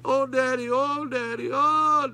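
high, wailing daughter's plea; imitating tearful daughter. Pastor voicing his weeping daughter, high and wailing. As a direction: cry out like a heartbroken daughter. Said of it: "told her I had cancer".

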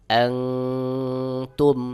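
আনতুম with the ন hummed long through the nose; ikhfa ghunnah. Shown as a demonstration. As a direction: hold a long nasal hum before তুম in আনতুম. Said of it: "যেমন". (আনতুম-এর নুন নাকে গোপন করে টেনে গুন্না)

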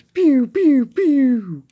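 high, loud falling "pew" shots; laser pew sounds. Pretend. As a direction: imitate toy laser blasts with high squeals. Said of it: "like they do in the series".

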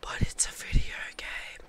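whispered objection; hushed, voiceless protest. Pretend. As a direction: argue back entirely in a whisper. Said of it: "You have to whisper".

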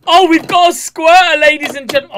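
loud, high exclamation; excited mock announcement. Real, not acted. Reactor announces to ladies and gentlemen, excited shout. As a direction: proclaim it loudly to the crowd.

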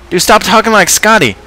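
very high toy voice; high-pitched exasperated objection; squeaky complaint. Acted.

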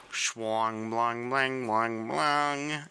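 droning clangs rising in pitch; clanging nonsense noises. Pretend.

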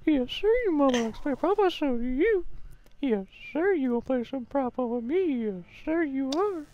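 sweet creepy coaxing. Pretend. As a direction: croon it like a leering stranger. High put-on voice teasing.

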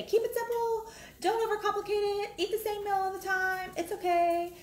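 high mock voice of fitness advisers; mocking advice-givers. Mocking what fitness people always told her, in a high voice. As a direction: parrot their advice in a high sing-song. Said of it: "they would always say".

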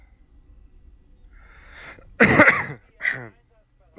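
long, loud cough from a sore throat; real coughing fit. Real, not acted. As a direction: cough through a cold, drawn out. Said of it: "Ja, also meine Stimme".

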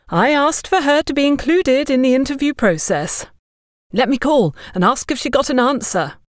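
preachy busybody. Acted. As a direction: lay down the law condescendingly. Agatha lectures about opportunities.

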